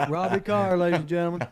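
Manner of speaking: loud, laughing host intro; laughing announcer intro. Acted. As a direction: announce him through a chuckle.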